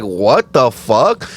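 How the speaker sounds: slow, dragged-out shocked question; slow stunned what-the. Retold or imitated. Waking to a lifted nail, slow stunned disbelief. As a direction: let the shock stretch out slowly. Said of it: "I was like".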